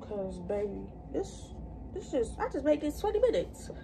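whispered, slowed words; quiet aside to viewers; hushed aside. Real, not acted. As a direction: drop to a breathy near-whisper. Unvoiced aside.

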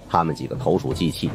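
plain reading voice

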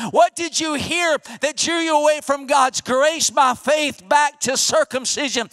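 usual speaking voice

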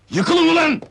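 loud, high burst of command; angry shout. Acted. Boss orders men to clear off, angry shout. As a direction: shout them out of the room.

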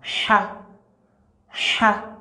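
"Cha" said with a smooth, soft breathed h, no throat scrape; smooth soft letter sound. Shown as a demonstration. Teacher drilling students on the smooth soft "Cha". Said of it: "tu n'as pas bien prononcé la lettre".

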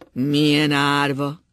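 anguished loud repeat; loud slow repeat. A narrator's reading. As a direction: press it out slowly. Repeated louder.

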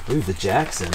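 normal talking voice

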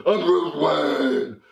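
slow, drawn-out mock hero voice; hammed-up vigilante, very slow; Batman. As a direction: draw out the name as a deluded superhero dad. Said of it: "He pretends to be Batman".

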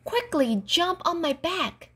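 high-pitched urgent call; urgent cry. A narrator's reading. Unicorn urges Wilma to jump on and flee, crying out. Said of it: "cried the unicorn".

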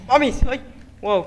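ordinary talk

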